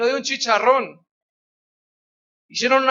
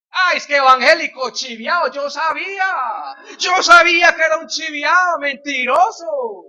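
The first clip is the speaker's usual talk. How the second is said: higher, louder taunting yell; higher, louder mocking accusation; mocking shout; 5 semitones higher, 6 dB louder than usual. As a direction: jeer at the fallen believer.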